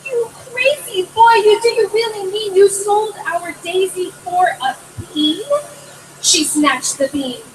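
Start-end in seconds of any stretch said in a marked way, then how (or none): 0.0-5.4 mother crying out. A narrator's reading.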